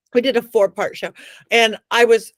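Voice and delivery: ordinary delivery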